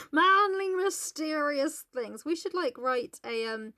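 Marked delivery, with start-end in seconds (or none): 0.1-2.2 raised, stretched-out mock mystery; hammed-up man of mystery; mock manly mystery. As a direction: hit the first word loud, then draw it out. Repeating the mock Heathcliff line.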